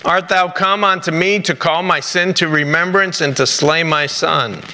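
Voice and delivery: ordinary delivery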